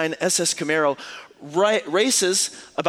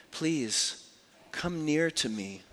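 tender invitation; 7 dB quieter, slower than usual. Acted. Joseph tenderly invites brothers.

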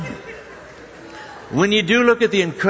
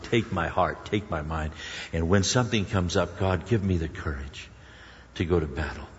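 low, soft prayer voice; earnest prayer; 7 semitones lower, 3 dB quieter than usual. Acted. Preacher modelling a prayer to God, soft and low. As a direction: pray it quietly and humbly. Prays aloud in the sermon.

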